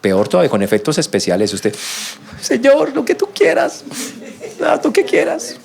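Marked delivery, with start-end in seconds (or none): 2.4-5.6 high, wobbling fake-weepy plea with a laugh in it; mock tearful prayer. Preacher mocking a believer's teary prayer, hammed-up weeping. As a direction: ham up a tearful surrender with special effects. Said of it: "se lo dijo llorando".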